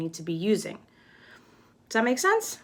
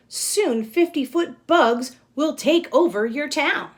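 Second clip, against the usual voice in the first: lively read-aloud, louder and higher; storybook reading; 4 semitones higher, 4 dB louder than usual. Acted. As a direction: read the rhyme with energy to the class. Reads picture book aloud.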